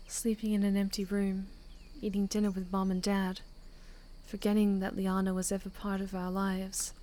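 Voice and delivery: softly voiced Holly, wistful then earnest; lightly voiced girl, gently wistful; quiet prayer reading. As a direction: read it like a private prayer-diary. Reads Holly's quiet reflection and prayer.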